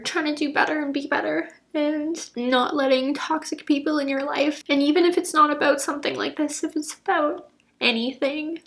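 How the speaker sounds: earnest, emotional pep talk, near crying; tearful encouragement. Real, not acted. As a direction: speak through tears of pride to the viewer.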